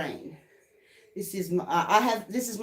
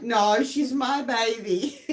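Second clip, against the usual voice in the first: laughing retort; 4 semitones higher, much slower than usual. Retold or imitated. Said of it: "And I'm like".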